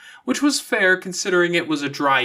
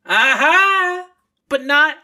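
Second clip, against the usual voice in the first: Aha! jumps much higher and louder; triumphant teasing; 14 semitones higher, 7 dB louder, slower than usual. A narrator's reading. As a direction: pounce on her like you caught her out. Verona catching Avery out, teasing.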